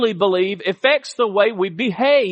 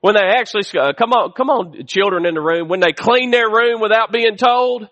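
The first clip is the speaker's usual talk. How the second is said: ordinary talk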